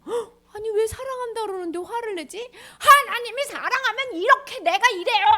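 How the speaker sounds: high, crying disbelief; shocked dismay. Acted. Teacher reacting to people's anger, shocked. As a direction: gasp in disbelief.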